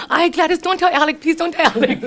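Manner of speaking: loud, high mock panic; hammed-up cry of alarm; panicked pleading. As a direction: beg frantically, as if caught red-handed. Caught grandma begs mom not to tell.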